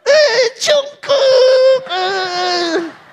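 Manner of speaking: high, very slow crying squeal; hammed-up teary fangirl; mock fangirl whine. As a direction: wail like a swooning fan. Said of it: "cuman kasih lihat fotonya gini doang".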